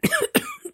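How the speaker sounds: strangled rasping cough; choking cough. A narrator's reading. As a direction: cough out a crushed throat. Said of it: "кашляя".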